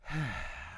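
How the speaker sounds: imitated sigh of relief; quiet exhale, acting out relief; relieved sigh. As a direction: let out a soft, acted settling sigh. Acting out her settling in, relieved.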